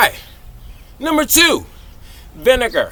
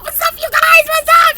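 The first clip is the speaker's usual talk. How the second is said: each What's up climbing higher and louder; building hype greeting; 5 dB louder than usual. Real, not acted. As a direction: hype vlog intro.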